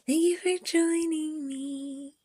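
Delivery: slow, high sugary host voice; high falsetto. Acted. Sudden host voice in a bit.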